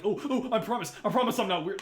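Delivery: high, loud pleading mimicry; mocking nervous voice. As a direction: whine it like a panicky fan.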